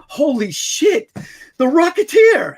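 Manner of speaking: slow awed then loud laughing shout; mock starstruck fan, rising to a shout. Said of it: "I'm like".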